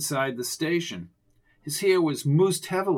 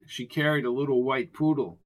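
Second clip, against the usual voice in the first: ordinary delivery, nothing marked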